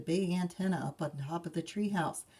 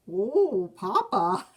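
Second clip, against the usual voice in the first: dismayed cub; 3 semitones higher, 9 dB louder, slower than usual. Acted. Cubs protest.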